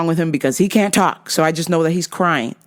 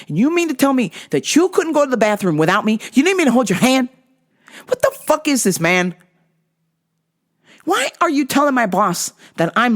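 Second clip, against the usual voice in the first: higher, heated mock tirade; imagined angry rant; 5 semitones higher than usual. Speaker ranting at the snitching coworker, heated. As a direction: tear into her like she betrayed you. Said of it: "And I'm like".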